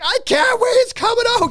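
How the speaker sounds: high, loud mock wail; panicking child. Child to parent cleaning the seat, loud high panicked wail. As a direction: cry out as a desperate toddler. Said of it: "He's going emergency".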